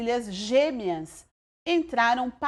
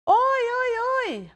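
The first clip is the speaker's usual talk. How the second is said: cheerful calling out; 12 semitones higher, 4 dB louder than usual. A narrator's reading. Grandparents call out arriving.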